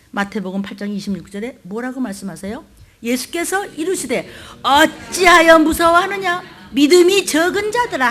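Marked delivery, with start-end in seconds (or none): 4.6-8.1 rebuke. Acted. Jesus rebuking his frightened disciples, loud scolding. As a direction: scold them hard. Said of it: "꾸짖으신데".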